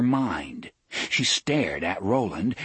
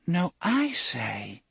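put-on higher, slow, sulky child voice; petulant little girl; 10 semitones higher, slower than usual. A narrator's reading. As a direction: talk like a small sulking girl. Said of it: "The faintly petulant voice of a little girl".

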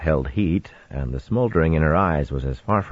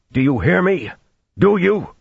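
much higher and louder, demanding; pleading shout; 13 semitones higher, 4 dB louder than usual. Acted. Husband demands his wife hear him, rising to a shout. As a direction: climb into a desperate shout.

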